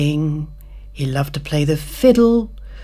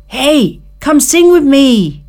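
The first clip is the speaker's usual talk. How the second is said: high, loud, cheery insect voice; carefree grasshopper; 10 semitones higher, 8 dB louder than usual. Acted. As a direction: call out like a happy-go-lucky grasshopper.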